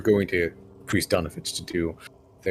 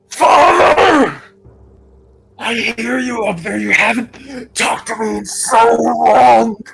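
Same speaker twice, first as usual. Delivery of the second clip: much higher, loud pleading voice from below; desperate shout from below; 10 dB louder than usual. Acted. Trapped son begging his father to let him out, high desperate plea. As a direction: plead and cry up from a cellar. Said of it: "you hear echoing".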